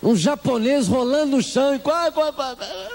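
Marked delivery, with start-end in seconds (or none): 1.8-2.5 mock laughter. Imitating japanese man laughing.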